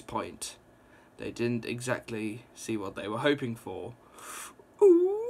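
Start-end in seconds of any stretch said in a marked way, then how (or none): 4.8-5.2 high, loud delighted squeal; a sudden gleeful squeal at the cliffhanger; excited squeal. Real, not acted. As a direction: squeal with thrilled glee. Gasps at the scary scene.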